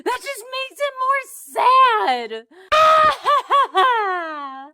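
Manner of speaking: whiny, sobbing lament; theatrical wailing. Pretend. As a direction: cry through the words in mock despair. Mock-sobbing over a sad chapter.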